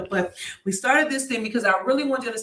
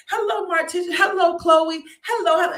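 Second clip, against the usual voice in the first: fake video greeting; 7 semitones higher, 4 dB louder than usual. Greeting her live viewers in a fake, high, bubbly voice. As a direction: put on a fake cheery livestream opener. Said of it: "It sounds so fake".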